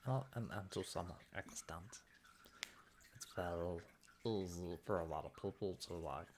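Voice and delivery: put-on silly voice, swooping up and down; silly little voice. Shown as a demonstration. Streamer showing viewers a voice everybody can do, silly little voice. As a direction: slip into a daft little character voice. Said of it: "silly little voice that they can do".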